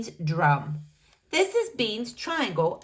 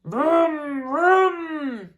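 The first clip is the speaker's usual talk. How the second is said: louder vroom vroom; engine vroom; 6 semitones higher, 6 dB louder than usual. A narrator's reading. Bean riding his motorcycle, louder. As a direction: rev like a motorbike.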